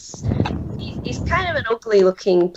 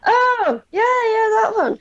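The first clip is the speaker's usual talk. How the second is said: sudden recall; 13 semitones higher than usual. Acted. Captain suddenly remembers the druid mission, much higher. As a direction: squeak out as it clicks.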